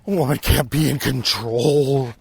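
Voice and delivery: slow, drawn-out mock indignation; put-on outrage, last word dragged long; mock protest. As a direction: stretch it out in hammed-up protest. Echoes the mocked protest, drawn out.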